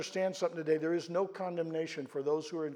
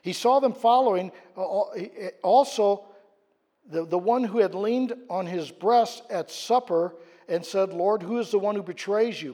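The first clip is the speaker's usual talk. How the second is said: rising preaching; 3 semitones higher, 7 dB louder than usual. Acted. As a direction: lift the story to the whole church. Preaching, retelling the Gospel.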